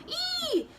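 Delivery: high piglet squeal; storyteller squeals as the little pig. Acted. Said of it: "just squealing".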